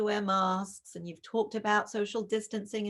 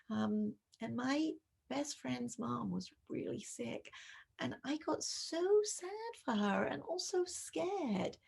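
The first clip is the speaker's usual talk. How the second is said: validating and tender; 3 semitones higher, 5 dB quieter than usual. Shown as a demonstration. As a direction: comfort her by sharing your own story. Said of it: "validate what she's feeling".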